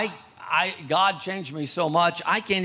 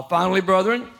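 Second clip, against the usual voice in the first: raised, ringing pulpit reading; preacher proclaiming Ephesians, louder and higher; scripture reading; 3 semitones higher, 4 dB louder than usual. Acted. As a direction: declaim the passage to the church. Reads scripture aloud.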